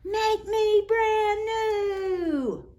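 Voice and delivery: very high, slow, drawn-out eerie voice; puddle voice. A narrator's reading. A voice in the puddle begs the children, high and slow. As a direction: stretch it out like a magic puddle calling.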